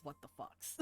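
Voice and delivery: whispered, far-off what the fucks; barely audible murmur; faint whisper. Retold or imitated. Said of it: "you can just very faintly hear".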